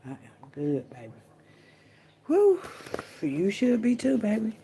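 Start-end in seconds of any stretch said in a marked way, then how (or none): 2.3-2.6 a loud, high exhale; high whew. Real, not acted. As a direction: let out a big high whew. Exhales after the mirror bit.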